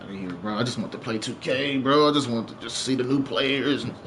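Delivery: low, soft mock of a dismissive friend; mocking gamer voice. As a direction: brush the topic off like a bored gamer who just wants his game. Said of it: "They're like".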